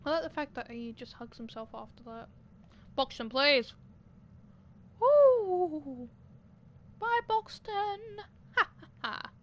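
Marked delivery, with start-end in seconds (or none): 3.0-3.7 loud, pleading call; loud plea. Real, not acted. Yelling at Boxton again. 5.0-6.1 loud, high cheer; triumphant woo. Real, not acted. Cheer after escaping. 7.0-9.3 high, slow, laughing goodbye; gleeful laughing. Real, not acted. Gleeful farewell while escaping.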